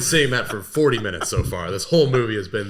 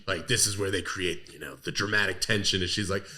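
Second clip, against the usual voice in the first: ordinary talk, nothing marked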